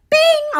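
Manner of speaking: high bing dropping at the end; high falling bing; notification ding. Pretend. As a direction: chime it out and let it fall. Mimicking a phone notification again.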